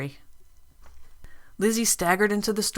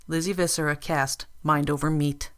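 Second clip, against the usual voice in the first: usual speaking voice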